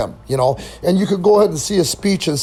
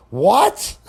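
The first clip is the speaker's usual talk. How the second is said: high, rising imitation of bewilderment; baffled reply; 5 semitones higher than usual. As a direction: imitate a baffled old-timer asking back. Vern retold as baffled.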